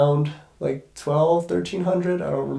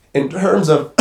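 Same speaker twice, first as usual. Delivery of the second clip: normal talking voice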